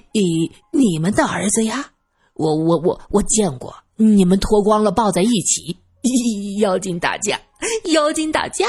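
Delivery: simpleton's stuttering, giggly taunt; silly giggling. Acted. Simpleton blurting out a secret affair, giggling foolishly. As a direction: tattle gleefully with little sniggers between phrases. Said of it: "二先生嘿嘿地笑着".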